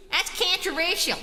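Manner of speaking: exaggerated southern "cantraversial", amused; southern twang on "controversial", first vowel as in "can't"; southern accent. Shown as a demonstration. Streamer replays the messed-up word for laughs, southern accent. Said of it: "doing my southern voice is messing up my".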